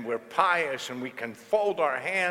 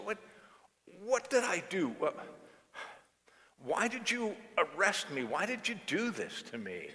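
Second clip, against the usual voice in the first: pitched-up baffled questions; puzzled protest; 7 semitones higher than usual. Acted. Woman asks the officer, pitched-up and baffled. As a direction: she can't see what she did wrong. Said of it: "really puzzled".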